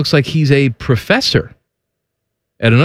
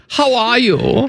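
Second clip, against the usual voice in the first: high, slow mock German accent; 6 semitones higher, slower than usual. [name] greeting his co-host, in a German accent. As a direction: draw out the greeting as a comic German. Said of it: "when I talk in this".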